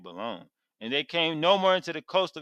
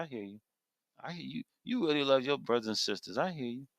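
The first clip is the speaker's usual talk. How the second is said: much softer, gentle voice of the Father; tender reassurance; 7 dB quieter than usual. Acted. The Father answers Samuel's cry, soft and tender. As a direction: quietly comfort the one who cried.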